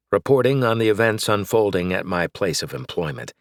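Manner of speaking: plain reading voice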